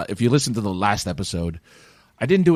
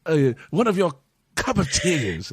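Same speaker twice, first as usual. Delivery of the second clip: higher, slow, hammed-up British request; mock British accent; 8 semitones higher than usual. Customer ordering from American staff, hammy British accent. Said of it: "a British accent".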